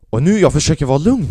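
loud, tense, barely holding it together; tense shout of forced calm; strained forced calm. Retold or imitated. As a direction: grit out the words as if you're about to explode. Straining to stay calm, losing it.

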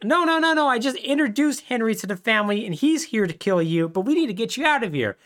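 high, hurried pleading; frantic denial. Retold or imitated. Podcaster voicing Vito insisting to Leo, high and hurried.

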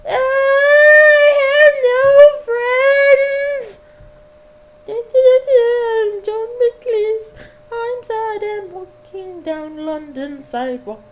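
very high, slow, hammed-up wail; mock-tragic crying, very high and drawn out; hammed-up sobbing. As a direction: wail it like a sad little man. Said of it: "All the voices were by me".

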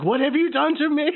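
high-pitched distraught plea; shaken, freaked out. Acted. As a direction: play it shaken, pitch shooting way up. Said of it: "shaking. I'm like".

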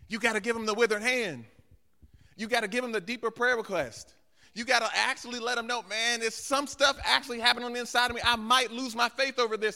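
loud, high, urgent exhortation; impassioned preaching. Real, not acted. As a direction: preach it hard, pressing every phrase. Preacher at full force.

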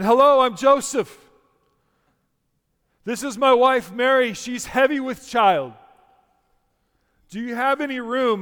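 put-on loud, high, over-projected stage recital without British accent; Joseph in a pageant. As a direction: announce each line to the back row. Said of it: "Joseph says again".

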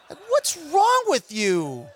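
slow, disbelieving challenge; a slow, baffled put-down; incredulous scold. Acted. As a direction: drag it out in disbelief. Scolding the listener.

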